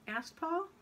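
plain reading voice

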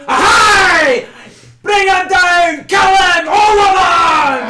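high, full-throated yelling; crowd battle cries. Acted. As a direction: yell like a riled-up mob of warriors. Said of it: "all the Yarbir start going".

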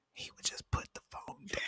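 soft hushed aside; whisper. Real, not acted. Host whispering his wish about Trump.

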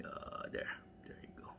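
very soft, half-whispered aside; barely audible busy murmur; hushed muttering. Real, not acted. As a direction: murmur to yourself as you work. Muttering to self while placing car.

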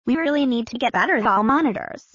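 normal talking voice

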